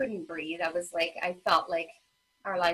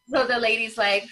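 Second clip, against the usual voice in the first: normal talking voice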